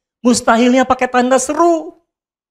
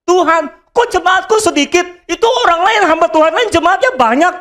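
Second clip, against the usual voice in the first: whiny higher complaint to God; complaining pastor; 8 semitones higher than usual. Retold or imitated. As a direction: pastor envious of bigger churches, mild mock whine. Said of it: "Saya nggak bisa bilang begini".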